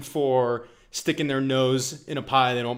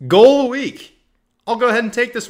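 excited announcement; 12 semitones higher, 10 dB louder than usual. Real, not acted. Podcast segment announcement.

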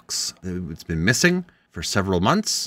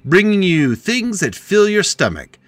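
ad read; 7 semitones higher, 6 dB louder, slower than usual. Acted. Mock ad read continues.

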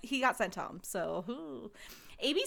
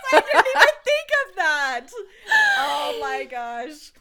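very high, loud, helpless laughing; shrieking laughter; 10 semitones higher, 13 dB louder than usual. Real, not acted. Co-host struck by the lookalike remark, cracking up. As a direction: squeal it through a laughing fit.

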